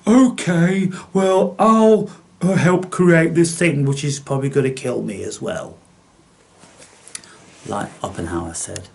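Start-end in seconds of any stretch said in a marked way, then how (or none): 0.1-5.8 higher, meek mock compliance; lightly voiced obedient scientist. Mimicking a scientist agreeing to build the weapon, higher meek voice.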